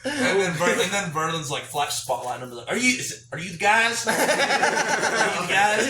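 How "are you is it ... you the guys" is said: high, loud, suspicious calling out; lightly voiced wary dealer; sketchy dealer voice. Drug dealer voiced in story.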